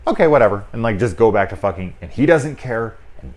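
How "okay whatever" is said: high, loud shrugging dismissal; mock careless couple, louder and higher; indifferent. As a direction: toss it off like nobody cares.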